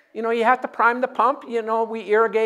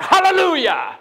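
higher, loud praise cry; Holy Ghost shout; 6 semitones higher, 4 dB louder than usual. Shown as a demonstration. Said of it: "a good Holy Ghost shout".

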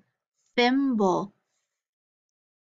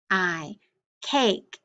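ordinary delivery

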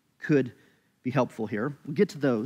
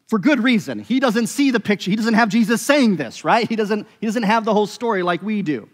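raised, louder preaching voice; fervent sermon push; emphatic preaching; 6 semitones higher, 4 dB louder than usual. Acted. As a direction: drive the point home from the pulpit. Emphatic preaching about Jacob.

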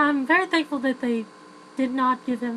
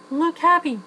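higher, louder called-out prompt; director's cue; 5 semitones higher, 7 dB louder than usual. Acted. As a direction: call it out like a director. Voicing a director's cues to actress.

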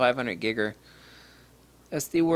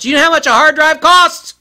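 much higher and louder, yelling; exasperated shout; 17 dB louder than usual. Acted. Yelling at users who lost data over a cheap drive. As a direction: blow up at the careless user.

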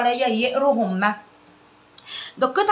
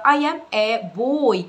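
slow drill; 3 semitones higher, 4 dB louder, much slower than usual. Shown as a demonstration. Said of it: "بدأ يقولها بسرعة".

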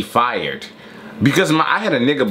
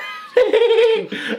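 laugh. Real, not acted. Laughing mid rant.

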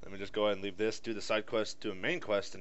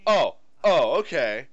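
startled; 4 semitones higher, 12 dB louder, slower than usual. Real, not acted. Startled by a jump.

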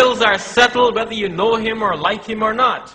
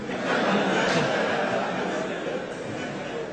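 amused laugh; 2 semitones lower, 8 dB quieter than usual. Real, not acted. Speaker laughs at own parable.

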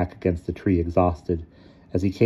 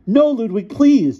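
put-on very high, loud child's plea; desperate begging; 7 dB louder, slower than usual. A narrator's reading. Tycho begs Ludwig to spare it, high and desperate. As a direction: beg him desperately to stop. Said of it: "Tycho begged him".

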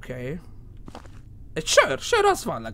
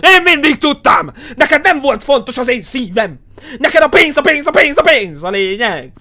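furious mock accusation, much louder and higher; angry yelling; 5 semitones higher, 8 dB louder than usual. Gamer yelling at the ghost wife about money, furious shout. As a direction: rage at her like a jilted husband.

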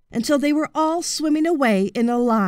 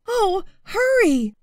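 urgent plea; 10 semitones higher than usual. A narrator's reading. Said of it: "the dragon kept saying".

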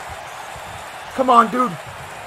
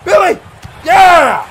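loud, high shouts; excited cheering; 7 semitones higher, 10 dB louder, much slower than usual. Real, not acted. Fan cheering Billy, excited shouting. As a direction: cheer your fighter on at full voice.

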